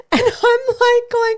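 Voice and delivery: high, giggling through the words; laughing. Real, not acted. Laughing telling awkward moment.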